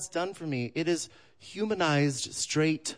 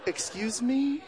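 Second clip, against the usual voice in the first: shocked; 8 semitones higher, slower than usual. Retold or imitated. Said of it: "I was like".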